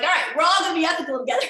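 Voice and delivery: fast, high and loud group cheer; rally the team with a burst of enthusiasm. Pretend. Rallying coworkers to be ethical together, fast and bright. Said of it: "Be like".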